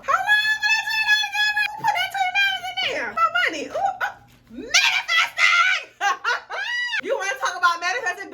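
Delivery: high, loud, laughing squeals of surprise; high-pitched surprised shouting with laughter; excited shriek. Real, not acted. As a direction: shriek with delight at the found money. Finds money in journal, shrieking.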